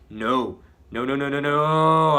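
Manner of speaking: loud, rising desperate shout of no; yelling no. Acted. Captured hero yelling at the crowd, loud desperate shout. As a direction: shout no as they close in. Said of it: "I yell as they surround me".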